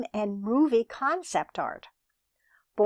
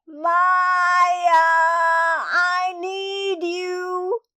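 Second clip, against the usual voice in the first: high, loud long-drawn call; 9 semitones higher, 7 dB louder, much slower than usual. Acted. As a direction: holler for Maya from down the block. Neighbour calling from down the block.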